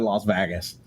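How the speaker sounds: LAS said as "loss", VEGAS with a short A; own odd pronunciation. Shown as a demonstration. Friend demonstrating how he says it, odd pronunciation. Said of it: "I say, I said".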